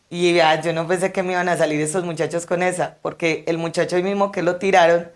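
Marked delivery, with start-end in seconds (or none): none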